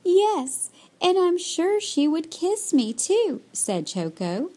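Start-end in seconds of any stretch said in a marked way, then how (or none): 0.1-3.4 put-on high, eager childlike voice; little bird character. A narrator's reading. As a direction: answer eagerly, pitched well up.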